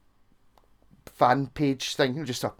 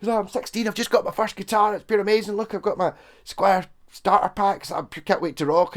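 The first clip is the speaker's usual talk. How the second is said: higher, lightly voiced excited sixteen-year-old; eager teen beginner; 6 semitones higher than usual. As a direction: play a kid thrilled with his first guitar. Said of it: "there's people go".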